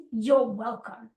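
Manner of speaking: slow, low self-assured you're welcome; calm proud you're welcome; confident. Retold or imitated. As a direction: hand it over like a gift. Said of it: "if I can't say at the end".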